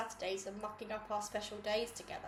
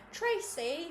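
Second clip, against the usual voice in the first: sudden high worried cry; alarmed call; 7 semitones higher, 4 dB louder than usual. Acted. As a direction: call her name in alarm. Cam rushing to catch her.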